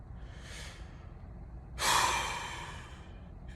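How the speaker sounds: long weary sigh. Real, not acted. Long pause in monologue.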